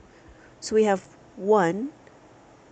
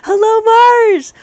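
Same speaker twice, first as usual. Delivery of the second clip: high, loud, slow call; drawn-out shouted greeting; 14 dB louder, much slower than usual. Real, not acted. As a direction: belt out a long hello. Calling out a greeting on stream.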